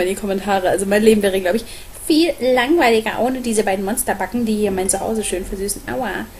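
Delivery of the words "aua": normal talking voice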